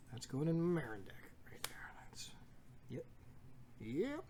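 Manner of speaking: hushed conspiratorial whisper; whispered aside. Real, not acted. Letting viewers in on a deck secret, whispered.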